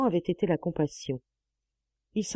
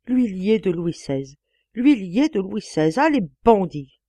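slow, loud grimacing lament building louder; clamoring; 3 semitones higher, 6 dB louder than usual. Acted. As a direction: wail the loss with a grimace. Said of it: "Joseph clamait avec d'atroces grimaces". (Joseph clame avec des grimaces)

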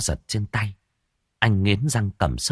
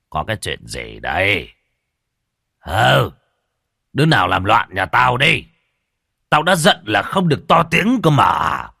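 put-on slurred drunk voice, rising into shouting; a drunk master bellowing at the noise; drunken slurring; 5 dB louder than usual. A narrator's reading. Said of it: "cất giọng lè nhè". (giọng lè nhè say rượu)

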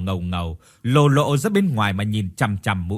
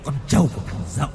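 schnell, verstellte Stimme; eerie Thọt. A narrator's reading. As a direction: rede schnell und unheimlich. Thọt voiced eerily.

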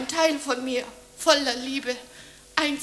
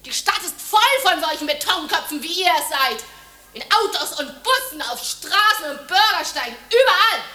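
louder, building to a shout; rising rant; 6 semitones higher, 8 dB louder than usual. Acted. Woman railing against the city's people, building to a shout. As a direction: build to the word überall. Ranting about city people.

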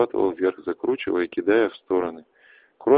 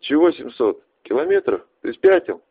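shouting over wind; 5 semitones higher, 7 dB louder than usual. Acted. Can't hear over wind.

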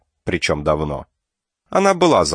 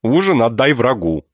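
bossy aunt; 5 semitones higher, 4 dB louder than usual. Acted. Aunt orders niece to skip dinner.

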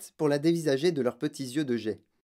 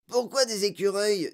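put-on higher-pitched boy's voice; questioning boy; 7 semitones higher than usual. A narrator's reading. Boy questioning the factory owner, higher voice.

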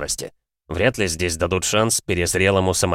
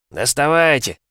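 angry; 6 semitones higher, slower than usual. Acted. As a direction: fire it at the opponents. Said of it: "зло ударил кулаком".

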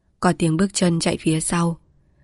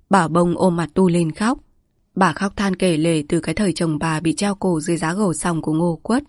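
ordinary delivery, nothing marked

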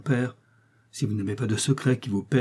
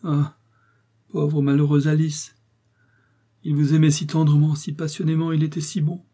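au bord des larmes; 5 semitones higher, 3 dB louder than usual. A narrator's reading. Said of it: "puis elle fondit en larmes".

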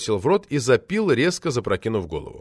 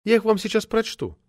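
eager woman poet; 11 semitones higher than usual. A narrator's reading. Voices Metelina offering verses.

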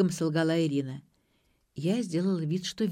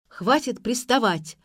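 loud, higher, slow exasperated yell; 6 semitones higher, 6 dB louder, slower than usual. A narrator's reading. Mother yelling at her pestering daughter. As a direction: yell at them to back off. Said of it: "заорала мать".

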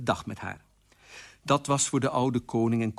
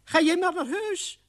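very high-pitched character voice; old aunt figure. Acted. Aunt Oena welcomes the queen, high character voice. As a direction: pipe it up in a high put-on voice.

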